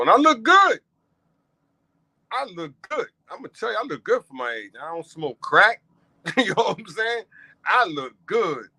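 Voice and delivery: high, loud bragging; boasting. Real, not acted. Host bragging about his age to viewers, high cocky boast. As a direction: show off your looks with a laugh.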